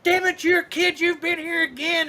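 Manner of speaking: high-pitched mock yell, not full volume; imitated angry scream. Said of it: "you hear this guy come screaming".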